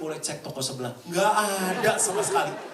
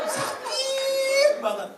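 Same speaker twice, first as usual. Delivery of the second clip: mock crying wail; 6 dB louder, much slower than usual. Recalling heartbreak, mock wail.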